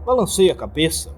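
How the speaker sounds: ordinary delivery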